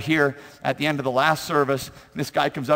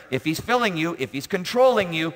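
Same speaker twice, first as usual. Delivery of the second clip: ordinary talk, nothing marked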